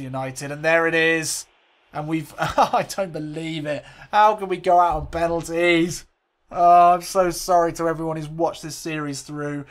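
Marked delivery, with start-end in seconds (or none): 4.1-9.6 louder, higher cry of dismay; anguished lament. Real, not acted. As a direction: plead in disbelief at the loss. Losing the final on penalties.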